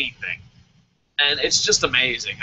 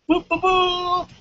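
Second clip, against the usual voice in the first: high, drawn-out "boop" noises; slow high-pitched booping; playful boops; 13 semitones higher, slower than usual. Pretend. Host filling time between comments, playful high boops.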